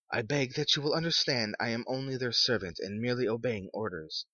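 a hint of a stiff official; formal messenger. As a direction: read it like a polite clerk. Visitor's formal voice.

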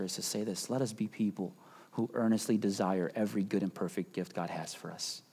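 soft, low, earnest plea; hushed heartfelt appeal. Real, not acted. Friend and pastor appealing to the church, softly.

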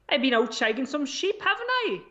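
put-on amateur sing-song Welsh accent. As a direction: have a go at a hammed-up Welsh accent. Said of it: "a Welsh accent, if you would".

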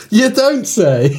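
sarcastic. Real, not acted. Sarcastic reply after laughing.

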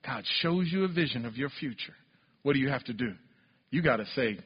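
low, soft, earnest preaching; intimate preaching. Acted. To the congregation, low hushed preaching voice. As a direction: draw them in close and quiet.

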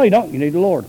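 ordinary talk, nothing marked

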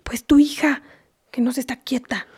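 unvoiced whispered complaint. Mother whispers her complaint about her daughter during mass. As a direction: whisper it so the priest won't hear.

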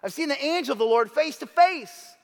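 awed exclamation. Acted. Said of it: "he exclaimed".

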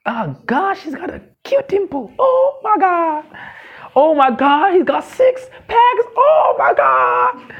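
put-on high, swooning teen gush; gushing crush. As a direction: swoon over him, drawn out and breathless.

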